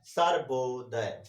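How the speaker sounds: Sarvodaya stretched syllable by syllable, slow and low; drawn out slowly. Shown as a demonstration. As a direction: draw out Sarvodaya slowly to show its length. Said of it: "Sarvodaya को बोलने में ज्यादा समय लग रहा है". (सर्वोदय को धीरे-धीरे खींचकर बोलना)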